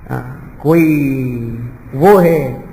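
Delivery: ordinary talk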